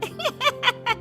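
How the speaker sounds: high-pitched villainous giggle; mean, high laugh; gloating laugh. Acted. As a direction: cackle with scheming glee. Scheming friend gloats over burnt face.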